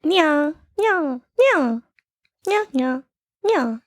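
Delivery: high cat meows; Japanese cat meow. Shown as a demonstration. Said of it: "that's a Japanese cat meowing".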